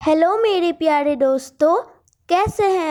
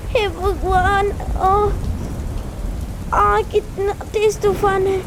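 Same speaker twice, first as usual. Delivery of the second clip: struggling against the storm. Acted.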